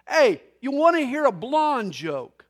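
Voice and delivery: loud, high holler to a buddy; yelling out. Acted. Blind guy at a ball game to his buddy, yelling out. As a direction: holler it across to a friend. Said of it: "he yells out to his buddy".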